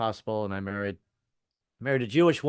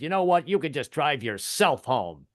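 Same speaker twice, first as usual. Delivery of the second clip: raised opening, then slow emphatic dismissal; macho defiance. Acted. Husband pointing at wife outside the car, mock macho outburst. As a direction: lay it down with mock macho bluster. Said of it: "pulling the macho trip".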